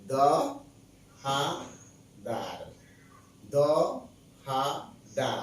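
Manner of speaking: দ, হা, দার as isolated syllables, twice over. Shown as a demonstration. Teacher demonstrating where one naturally stops, spaced-out দ, হা, দা. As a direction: pause after every দ, হা and দা. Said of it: "আপনি থামতেছেন না".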